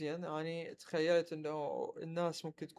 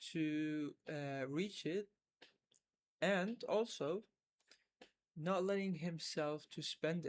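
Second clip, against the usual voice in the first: slow teaching English; 3 dB quieter, much slower than usual. Acted. Slow English explanation for learners.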